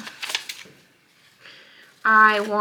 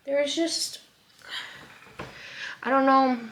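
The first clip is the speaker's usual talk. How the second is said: tearful; 4 semitones higher than usual. Real, not acted. Still crying after talking about infertility.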